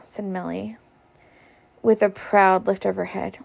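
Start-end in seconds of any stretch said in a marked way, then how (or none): none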